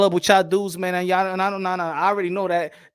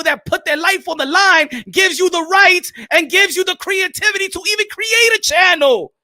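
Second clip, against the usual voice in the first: raised, louder angry rant; 6 semitones higher, 4 dB louder than usual. Real, not acted. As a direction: tear into the channels. Ranting at the audience.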